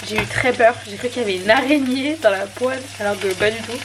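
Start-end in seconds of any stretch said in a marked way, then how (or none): none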